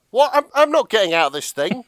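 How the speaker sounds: high, loud mock-panicked refusal; hammed-up frightened protest; Jack. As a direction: balk in comic alarm. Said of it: "And Jack's like".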